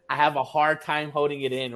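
usual speaking voice

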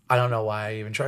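low, quiet, put-upon grumble; passive-aggressive Jesus. Acted. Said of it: "Jesus just becomes passive aggressive".

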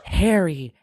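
loud, startled gasp of a name; gasped reveal. Retold or imitated. Retelling the film's reveal to a co-host, shocked. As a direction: act out a shocked recognition, loudly. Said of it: "the reveal is there and he just goes".

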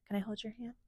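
soft whispered request; little sister whispering to her brother. Acted. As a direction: ask gently in a hush. Small character asks gently.

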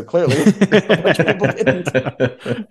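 a real laugh breaking into the line. Real, not acted. Joking about the voting results.

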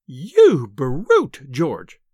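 loud, drawn-out cry of outrage; acted scream of a furious wife. A narrator's reading. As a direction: cry out at your husband in fury. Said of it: "she screamed".

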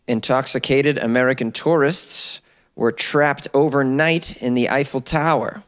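ordinary talk

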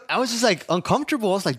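usual speaking voice

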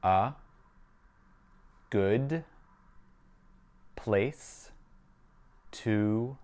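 very slow, long pause after each word; measured language-drill dictation. Shown as a demonstration. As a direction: isolate every word for a learner to repeat. Teacher models phrase word by word.

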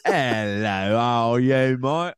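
playful British-style greeting; mock British accent. Co-host greeting listeners at the top, mock British accent. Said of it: "It has to be British".

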